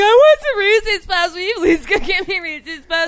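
high, whiny imitation of a begging friend; mock him like a spoiled kid; teasing a friend about craving cereal, whiny high voice. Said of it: "you're gonna be like".

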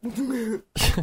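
long audible yawn. Real, not acted. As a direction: let out a big yawn. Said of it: "I'm yawning now because of you".

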